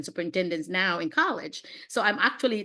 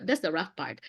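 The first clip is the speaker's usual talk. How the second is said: normal talking voice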